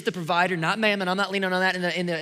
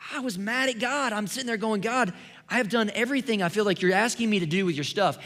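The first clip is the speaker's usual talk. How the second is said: frustrated; 6 semitones higher, 4 dB louder than usual. Retold or imitated. Venting anger at God.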